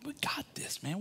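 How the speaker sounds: whispered and high, sure of winning; smug whisper. Retold or imitated. Prophets whispering to each other they've got this. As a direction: lean in and whisper confidently. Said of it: "they're like".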